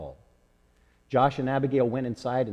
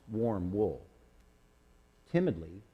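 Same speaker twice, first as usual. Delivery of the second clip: ordinary delivery, nothing marked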